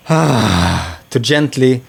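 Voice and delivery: sighing, very slow and airy. Shown as a demonstration. Voice coach showing a calming breath, slow airy sigh. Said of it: "taking a big breath and just allowing the sounds".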